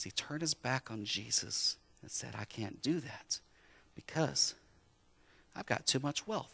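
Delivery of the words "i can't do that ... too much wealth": sad. Retold or imitated. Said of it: "that young man was sad".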